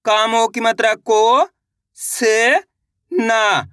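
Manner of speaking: slow "को", "से", "ना" with pauses; teacher breaking the word into "को, से, ना"; sounding out syllables. Shown as a demonstration. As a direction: stretch "को" and "से", each syllable by itself. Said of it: "इसी तरीके से इनको पढ़ा".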